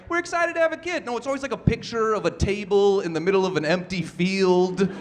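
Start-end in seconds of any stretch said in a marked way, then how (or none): 0.1-1.0 mock cheesy announcement. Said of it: "Nobody ever just posts words like".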